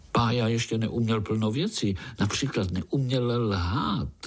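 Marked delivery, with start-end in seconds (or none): none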